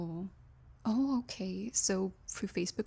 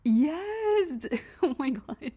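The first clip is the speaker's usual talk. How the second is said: sudden high excited yes; 6 semitones higher, 4 dB louder, much slower than usual. Acted. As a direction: burst out, delighted. Excited recognition.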